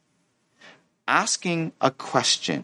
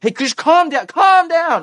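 high-pitched, escalating TV-drama yell; hammed-up urgent shouting; shouted command; 5 dB louder, slower than usual. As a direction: shout them down, louder the second time. Mimics TV-style calming someone by shouting.

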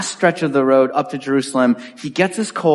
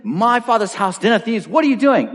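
mock outburst; 6 semitones higher than usual. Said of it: "just loses it".

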